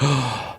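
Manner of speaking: sharp acted intake of breath; retold gasp of relief; relieved gasp. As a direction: gasp as if the call finally connects. Retelling wife finally answering the phone.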